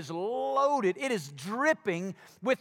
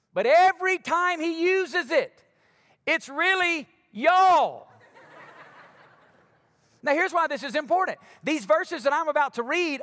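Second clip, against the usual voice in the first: loud, high, energetic sermon delivery; loud high pastor firing up the congregation; full-force preaching; 3 semitones higher, 4 dB louder than usual. Acted. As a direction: preach it to the room, punching each point.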